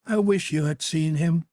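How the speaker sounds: put-on a dreamy young actress longing aloud; young Sybil, wistful. Sybil to her brother about her lover, wistfully.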